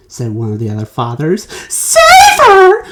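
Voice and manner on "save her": very high, loud indignant cry. A narrator's reading. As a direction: cry out in outrage. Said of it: "cried Missus Beauregard".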